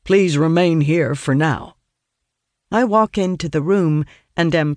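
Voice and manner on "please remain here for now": flat, even guard voice; monotone guard. A narrator's reading. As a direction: say it like a bored guard. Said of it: "in a monotone voice".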